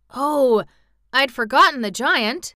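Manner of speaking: sudden drawn "oh", then worried admission; alarmed realization. A narrator's reading. General reminded of the giant, turning pale with alarm. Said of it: "turning pale".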